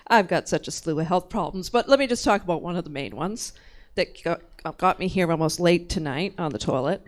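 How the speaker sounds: ordinary talk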